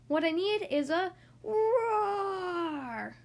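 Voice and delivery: slow, stretched little growl; mock roar. Tiny mouse tries a slow growling roar. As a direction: try to sound big and fierce.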